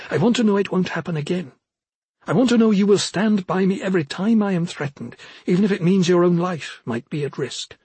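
impassioned Arjun. Acted. Commander appealing to her only close friend for loyalty, impassioned.

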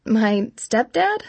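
slow, drawn-out, unsure question; hesitant answer. A narrator's reading. Girl answering her trainer about her stepdad, hesitantly. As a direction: answer haltingly, as if asking. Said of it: "I answered hesitantly".

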